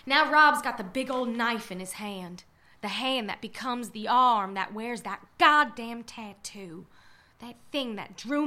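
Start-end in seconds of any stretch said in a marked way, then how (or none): none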